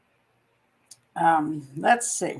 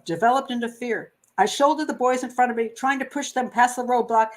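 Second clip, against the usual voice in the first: normal talking voice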